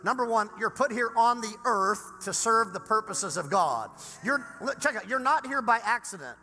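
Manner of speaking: loud, high fired-up preaching; preaching at full force. Acted. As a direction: build to a shouting sermon.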